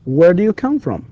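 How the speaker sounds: high on "come", falling through "from"; falling intonation. Shown as a demonstration. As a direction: repeat it for learners, pitch dropping at "from". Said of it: "and falling intonation here".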